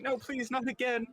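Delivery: high, begging no, please; frightened pleading. Retold or imitated. Noblewoman recalling the attack, pleading in fright. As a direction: beg in a high, scared voice. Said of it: "that's why she's saying".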